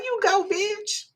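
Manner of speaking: high, slow, rising cheer; drawn-out cheer. Retold or imitated. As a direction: savour every word of the praise. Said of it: "but I was like".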